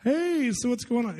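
high, slow, sing-song phone greeting; prying church caller. Retold or imitated. Church member phoning a latecomer, high drawn-out mock friendliness. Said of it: "you get a phone call like".

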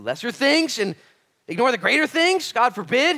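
high, loud exhortation; impassioned rhetorical outcry; full-force preaching. Real, not acted. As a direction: preach it at a shout.

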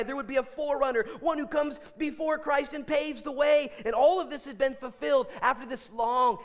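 high, loud, driving proclamation; sustained high-pitched pulpit fervor; impassioned preaching. Real, not acted. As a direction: preach it at full force, building to a climax. Preaches at full force.

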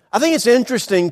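ordinary delivery

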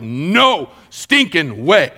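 NO drawn out slowly, hard stress on each word; emphatic no. Retold or imitated. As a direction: say "No stinking way" as a slow, emphatic refusal. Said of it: "that is an emphatic no".

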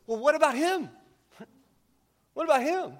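pointing at the other to dodge; whiny deflection. Retold or imitated. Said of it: "he says".

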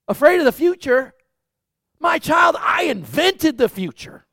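high, loud, booming God; God's voice. Acted. God answers his frightened child, booming and high. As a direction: boom it out like a loving father. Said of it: "God's looking down on us and going".

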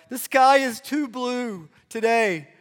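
put-on high, slow whining four-year-old; mimicking a whiny toddler complaining; whiny kid voice. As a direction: whine it like a cranky child. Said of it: "he gets whiny".

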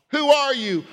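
high, loud "Who are you?" with "are" punched; pressing question. Shown as a demonstration. Preacher repeatedly pressing the congregation: who are you. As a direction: demand it with "are" stressed. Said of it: "If I were to say".

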